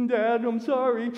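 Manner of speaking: high, loud mock crying; exaggerated tearful apology; imitated bawling child. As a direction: wail it like a sobbing kid, hammed-up. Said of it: "He was just crying and bawling".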